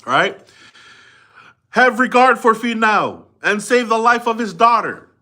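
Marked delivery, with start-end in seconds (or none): 1.7-5.1 high, loud ceremonial plea; Matapule pleading. Retold or imitated. Matapule begging the god to save Finau's daughter, high and loud. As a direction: beseech the god like a chief's orator. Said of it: "This is the Matapule talking to the god".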